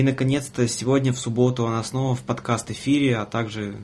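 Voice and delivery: usual speaking voice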